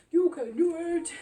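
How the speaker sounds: loud, high, hyped-up infomercial trainer cheer; Tony Little impression. Trainer cheering viewers on in an overexcited infomercial voice. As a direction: cheer it like an eighties fitness pitchman. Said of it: "Tony Little?".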